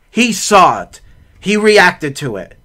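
ordinary talk

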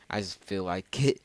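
ordinary delivery

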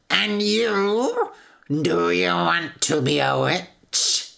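slow, drawn-out witch voice; sinister, deliberate storybook witch; menacing witch. A narrator's reading. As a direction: question slowly like a threatening witch. Witch interrogates the prince.

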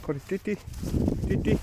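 high squeaky dog call; squeaky praising a dog. Real, not acted. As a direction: squeak at the puppy. Calling dog Kori.

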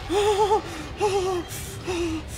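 high panicked moans, tailing off; frightened wails. Real, not acted. Gamer just escaped the thing, panicked wailing. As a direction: wail in short high bursts of fear. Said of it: "Oh God, I'm already scared".

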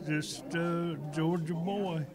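ordinary talk, nothing marked

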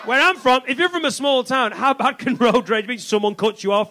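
ordinary delivery

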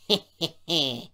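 loud acted snigger; sly "heh, heh, heh" chuckle. A narrator's reading. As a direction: snigger slyly in three short bursts. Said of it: "sniggered Sir Handel".

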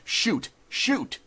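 high, loud urgent command; crying out. A narrator's reading. Judge urging the gunman to fire, high urgent cries. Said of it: "cried the judge".

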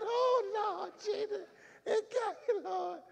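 put-on young minister seizing up mid-announcement, high panicked cry; reenacted panic. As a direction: squeak it out like the words just locked up.

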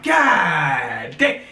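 retold shocked shout at the fight; stunned shout. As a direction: yell it in shock. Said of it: "I'm like".

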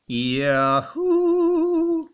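very high, long-held hoot; mock yahoo whoop. Mocking the British Yahoo, high drawn-out whoop. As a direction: stretch the last vowel into a high, sustained whoop.

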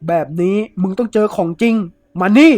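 loud, rising to a yell on the last words; angry shout. Acted. Thief drags the woman off, angry shouting. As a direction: bellow at her as you grab.

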